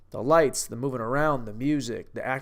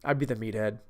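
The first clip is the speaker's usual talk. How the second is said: ordinary talk, nothing marked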